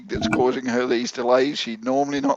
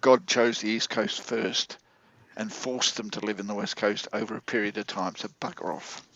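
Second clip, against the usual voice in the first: mock testy; 5 semitones lower, 6 dB quieter than usual. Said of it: "Somebody is testy today".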